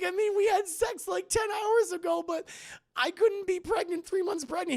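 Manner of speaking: very high, loud put-on voice; imitated bewildered patient; mock distraught woman. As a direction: protest in a squeaky panic. Acting her bewildered denial.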